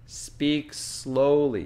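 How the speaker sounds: slow, stretched-out request; retold reminder to slow down; slow instruction. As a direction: draw the words out for the listener. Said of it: "I had to tell my family".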